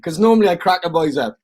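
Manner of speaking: usual speaking voice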